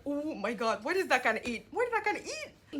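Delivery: retold excited squeal, rising high. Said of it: "and I was like".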